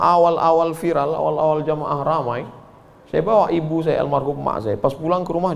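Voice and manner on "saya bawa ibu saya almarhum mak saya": ordinary talk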